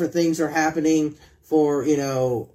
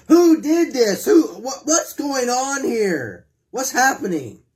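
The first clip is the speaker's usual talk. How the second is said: outraged townspeople; 5 semitones higher, slower than usual. Acted. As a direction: play the shocked crowd demanding answers. Townspeople demanding who wrecked altar.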